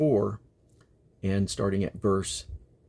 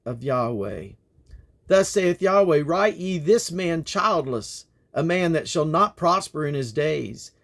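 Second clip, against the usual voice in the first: raised, grave declaiming of prophecy; prophetic reading; 6 semitones higher, 3 dB louder than usual. Acted. Reader voicing God's judgment, grave declaiming. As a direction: proclaim judgment with rising force.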